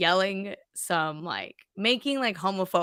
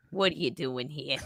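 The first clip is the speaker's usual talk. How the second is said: put-on lower, softer grumpy old voice; bitter old person; 5 semitones lower, 8 dB quieter than usual. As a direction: grumble like a bitter oldster. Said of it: "those just bitter old people".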